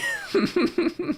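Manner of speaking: very high, squeaky laughing fit; helpless laugh. Real, not acted. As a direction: collapse into real laughter. Real laughing fit at crude joke.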